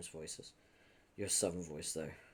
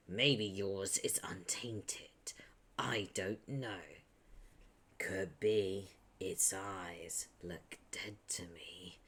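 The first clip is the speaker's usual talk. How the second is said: slow, uneasy whisper of a wary character; much slower than usual. Acted. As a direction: murmur the doubt like a superstitious friend. Reader holds a whispered character voice.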